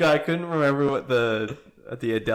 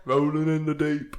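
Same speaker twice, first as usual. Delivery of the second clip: put-on weird British accent. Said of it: "with a weird British accent".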